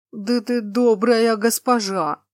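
loud, slow gurgling cyclops voice; slime. A narrator's reading. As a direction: burble it like a tearful swamp giant. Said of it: "низким булькающим голосом пробормотал циклоп".

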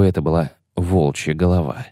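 ordinary delivery

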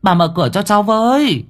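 high-pitched calls through a door; urgent calling. A narrator's reading. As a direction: call out anxiously to rouse someone inside. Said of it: "tiếng gọi gấp gáp". (gọi gấp gáp, giọng cao)

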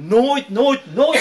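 high, loud hammered repetition; retold bitter outburst. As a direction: act out a hurt son crying out his grievance. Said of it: "toen kwam het allemaal eruit".

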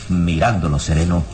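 usual speaking voice

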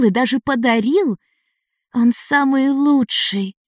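put-on a child gushing about her hero; adoring young girl. A narrator's reading. Ada speaks of Gekadas.

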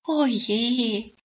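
high elf sigh of dismay; a small high oh no. Acted. Elf finding her forest cleared, high dismay. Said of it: "rief sie".

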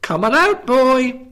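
high, loud playful trilling call; sing-song teasing wife calling under the bed. A narrator's reading. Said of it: "his wife trilled playfully".

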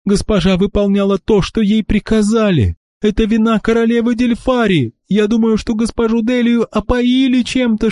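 high tearful pleading voice; angry crying. A narrator's reading. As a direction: plead high through sobs. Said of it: "вскричала она сквозь слёзы".

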